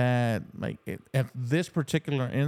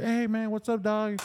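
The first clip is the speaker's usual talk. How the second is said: imitating a friendly greeting; 8 semitones higher than usual. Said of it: "he said".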